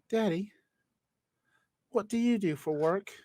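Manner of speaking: put-on a British kid asking his dad; British child accent. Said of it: "I don't know why he's British".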